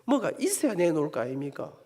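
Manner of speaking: low, soft, sulky grumble at God; fuming retort. Retold or imitated. Angry young man grumbling back at God, low resentful mutter. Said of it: "열불이 확 터졌습니다".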